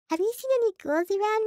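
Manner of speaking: high, loud little pony chirp; cutesy pony voice. Acted. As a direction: ask it sweet and bright.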